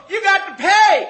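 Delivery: high, loud yell after him; hollered demand. Retold or imitated. Crew stopping the jumper to pay, hollered demand. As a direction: shout the demand across a distance.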